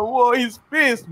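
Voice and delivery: high, drawn-out mock astonishment; retold wide-eyed reaction; amazed. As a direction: stretch it out in high-pitched disbelief. Retells his own stunned reaction.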